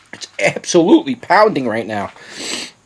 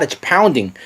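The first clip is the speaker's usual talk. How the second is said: ordinary delivery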